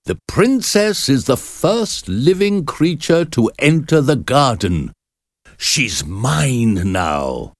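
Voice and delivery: high-swooping triumphant astrologer; gloating storybook claim. A narrator's reading. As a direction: crow over the prize, slowing right down at the end. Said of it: "shouted the astrologer triumphantly".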